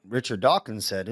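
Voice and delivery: normal talking voice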